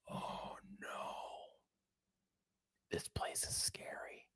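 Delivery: hushed, breathy mock-terror whisper; mock frightened whisper. Mocking the tourist scared at JFK, a fake-frightened whisper. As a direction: whisper it like a kid scared of the dark.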